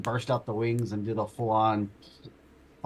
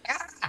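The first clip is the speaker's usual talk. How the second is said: scream; 4 dB quieter than usual. Acted.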